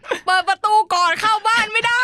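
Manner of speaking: very high and loud pleading; urgent plea. Retold or imitated. Stuck outside, begging parents loudly and high. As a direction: cry out to open the door.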